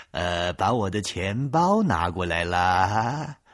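slow, lordly mob-boss drawl; gangster boss voice. Acted. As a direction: play the big-shot boss. (慢吞吞的黑老大腔调)

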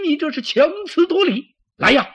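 very high, loud rebuke; outraged commander. Acted. General accuses his deputy and calls guards, outraged. Acted quarrel between two generals.

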